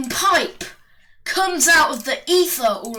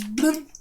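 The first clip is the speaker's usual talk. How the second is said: short higher plop; imitated bloop; 4 semitones higher than usual. Narrator mimics a Koopa Trooper dropping, short high bloop. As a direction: pop out a little falling-turtle noise. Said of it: "This one goes".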